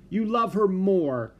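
hurt accusation. Acted. Ramona accuses mother.

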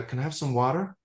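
Americanised "water" with a flapped t and an r at the end; a put-on American "water"; American accent. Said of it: "eventually I break down and go".

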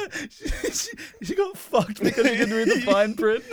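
high, laughing through words. Real, not acted. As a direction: giggle through the line. Laughing at the witch.

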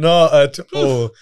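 NOT AT ALL drawn out slowly; emphatic denial. Real, not acted. Nottingham man rejecting the charge of being tight, emphatic denial.

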